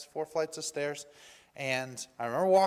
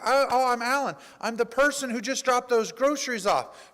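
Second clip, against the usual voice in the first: high, loud, eager introduction; retold eagerness; 7 semitones higher, 6 dB louder than usual. Helper telling the caller who he is, excited. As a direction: introduce yourself expecting thanks. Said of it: "very excited".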